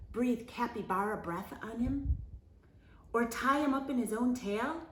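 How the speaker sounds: usual speaking voice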